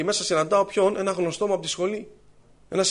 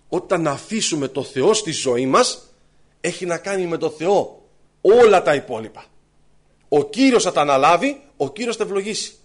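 slow, loud preaching to the congregation; louder, fervent sermon voice; 7 dB louder, slower than usual. Acted. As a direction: proclaim it, spacing the words. Preaches to the brothers.

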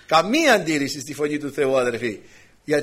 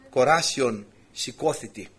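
usual speaking voice

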